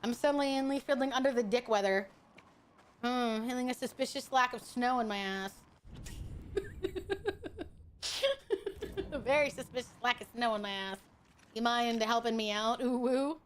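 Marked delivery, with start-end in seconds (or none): 5.9-7.6 soft, high giggling laugh; light, high-pitched chuckling; amused laugh. Real, not acted. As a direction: giggle quietly at your own silly joke. Amused by own wordplay.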